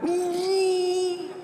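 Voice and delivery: a touch of crying, pained plea; mock labour pains. Preacher imitates a mother in labour swearing off pregnancy. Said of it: "Pernah lihat ibu hamil melahirkan?".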